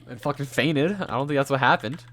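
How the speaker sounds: ordinary talk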